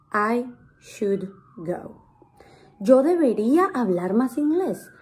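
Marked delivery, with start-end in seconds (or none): 0.1-2.0 soft "I... should... go", word by word; very slow, quiet, evenly separated words; slow model sentence. Shown as a demonstration. Teacher translating an example for learners, slow model sentence.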